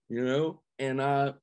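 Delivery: ordinary talk, nothing marked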